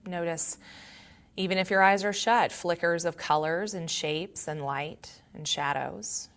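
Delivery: calm, quiet meditation voice with long pauses; guided meditation. Acted. Yoga teacher leading students to notice body and breath, calm guided meditation. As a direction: guide them gently inward, leaving long silences.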